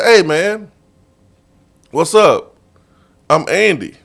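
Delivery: lightly voiced golfer, high and loud; friendly greeting. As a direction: greet back cheerfully. Golfer answering, retold.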